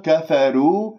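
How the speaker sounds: "كفروا" with a thin, light r; thin out the ر in "كفروا" like kaf and fa; wrongly light ra. Shown as a demonstration. Teacher shows the second mistake, over-light ra in كفروا. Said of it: "ترقق الراء بسبب الكاف والفاء المرققتين".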